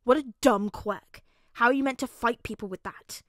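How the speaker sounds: put-on loud, scornful child's put-down; mock it with punched stresses; scoffing. A narrator's reading. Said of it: "Bakugo scoffed".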